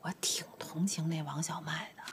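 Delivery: unvoiced hushed confession; whisper. Daughter confides to mother, whispering. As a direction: whisper it like a secret. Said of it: "我说句招你不高兴的话啊".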